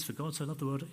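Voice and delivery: quick, quiet, offhand recitation; dismissive recitation. Acted. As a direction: rattle it off as overfamiliar words. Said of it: "I've read it a hundred times. I know what it says".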